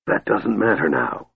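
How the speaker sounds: frail, broken-voiced mage; shattered voice. A narrator's reading. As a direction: cut in with a worn-out voice. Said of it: "interrupted in his shattered voice".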